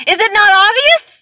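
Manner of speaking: high, loud rising question; exasperated disbelief. Retold or imitated. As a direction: act out incredulous outrage, pitch soaring. Retold exasperation, then repeated lower.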